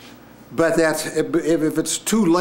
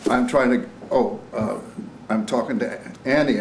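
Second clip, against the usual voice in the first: ordinary delivery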